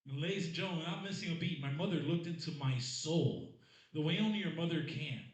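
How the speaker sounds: ordinary talk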